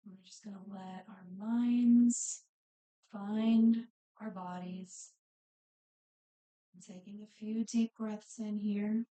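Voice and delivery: unhurried breathing cues, phrases spaced far apart, softening as it goes; guided meditation. Real, not acted. Yoga instructor settles the class into breathing and body scan, guided meditation.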